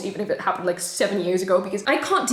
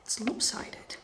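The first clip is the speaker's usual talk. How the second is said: much softer, under her breath; whispered aside; 10 dB quieter, slower than usual. Real, not acted. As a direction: mutter it to herself. Aside fixing the camera.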